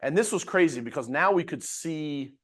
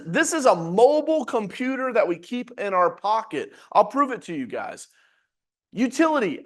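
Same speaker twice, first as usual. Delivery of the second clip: normal talking voice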